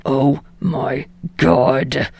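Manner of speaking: slow, low exasperated groan. Acted. Bakugo fed up with Deku's rambling, slow low groan. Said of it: "Bakugo groans".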